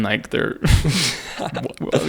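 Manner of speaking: ordinary delivery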